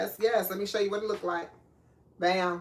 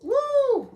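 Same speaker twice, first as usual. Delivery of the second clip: high, loud whoop of discomfort; stung "woo"; 7 dB louder than usual. Real, not acted. Cook with eyes on fire from shallots, whooping high. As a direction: yelp "woo" as the fumes hit. Onion fumes sting the eyes.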